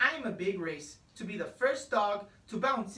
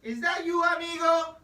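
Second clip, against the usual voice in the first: a loud, high, joyful shout to a friend; 6 semitones higher, 6 dB louder than usual. A narrator's reading. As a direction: shout across to the comet, delighted. Calling to friend on comet.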